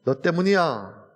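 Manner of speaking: slow, pointed blaming; accusing. Acted. Adam blames another for his fall, accusing.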